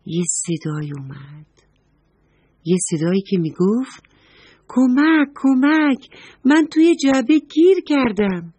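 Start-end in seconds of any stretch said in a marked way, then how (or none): 4.7-8.5 higher little cry for help; small trapped voice. Acted. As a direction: call out for rescue from inside the box. Trapped pencil calls from box.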